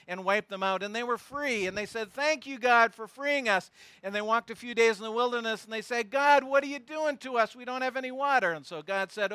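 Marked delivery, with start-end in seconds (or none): 2.1-3.7 grateful thanks. Retold or imitated. Grateful Israelites voiced. 6.1-8.5 higher, louder mock whine at God; whiny complaint.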